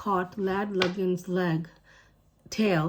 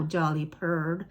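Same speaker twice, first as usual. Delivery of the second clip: ordinary delivery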